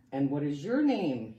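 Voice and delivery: ordinary delivery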